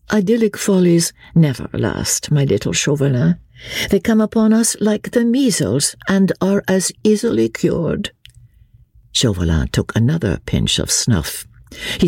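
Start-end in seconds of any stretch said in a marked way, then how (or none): 0.1-8.1 high, breezy society lady; lively lady, flippant. Acted. Marguerite dismisses love to her little Chauvelin, flippant and high. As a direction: wave it away in a high, light voice.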